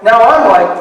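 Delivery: usual speaking voice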